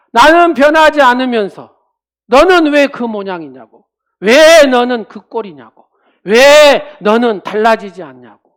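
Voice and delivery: loud browbeating demands; scolding shout. Retold or imitated. Preacher mimicking a spouse shouting blame. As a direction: bark the blame at them. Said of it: "윽박지르면".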